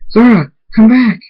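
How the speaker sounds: high, loud shout after someone; screamed plea. A narrator's reading. Hunter screams after Zara as she flies off, desperate. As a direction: scream for them to return. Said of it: "Hunter screams".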